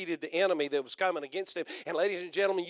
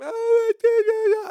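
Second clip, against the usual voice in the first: mock whiner; 10 dB louder than usual. Mocking his whining sister.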